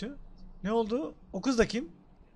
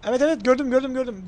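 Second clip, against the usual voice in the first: excited; 4 semitones higher, 7 dB louder than usual. Real, not acted. Spotting target in game.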